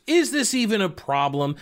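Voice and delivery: high, scoffing question; mocking question. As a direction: ask it with fake doubt. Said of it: "Now it's".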